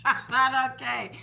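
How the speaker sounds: loud, laughing echo of the refusal; laughing repeat. Retold or imitated. Repeating her answer to the nurse, loud and laughing. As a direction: laugh through it and lean on not.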